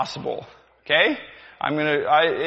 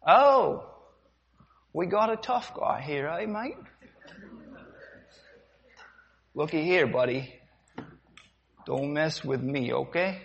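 softer, sneering menace; quiet slaver taunt; 6 dB quieter than usual. Acted. Sailor sneering at a defiant slave, quiet menace. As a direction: taunt the tough guy up close. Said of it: "The demonstration".

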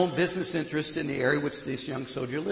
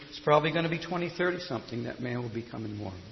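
usual speaking voice

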